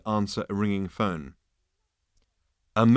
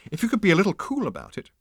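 fast, higher, prissy reply; snippy insect; 10 semitones higher, faster than usual. Acted. Fragile insect snapping at Zaphod, fast and prissy. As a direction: tell him off in a hurry. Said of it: "snapped the fragile little creature".

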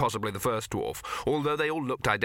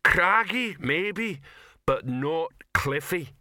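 higher, deliberate troll voice; hurt troll conceding a little; pondering troll; 6 semitones higher, 3 dB louder, much slower than usual. Acted. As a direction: weigh each word slowly, offended.

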